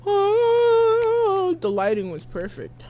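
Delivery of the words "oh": drawn-out, high-pitched "oh"; swooning high oh; long high coo. Real, not acted. Streamer swoons at the cutscene.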